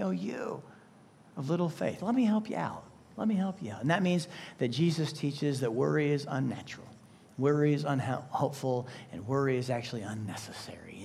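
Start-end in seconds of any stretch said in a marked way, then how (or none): 0.0-3.8 soft, gentle reassurance; caring. Retold or imitated. Jesus comforting worried followers, much softer and tender. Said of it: "saying this in a caring way". 9.1-11.0 hushed, breathy whisper. Real, not acted. Preacher closing his three points on worry, whispered.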